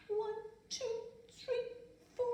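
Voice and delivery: high, small, frightened counting; a shaky-feeling high count, too scared to speak up. Acted. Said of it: "in that kind of voice".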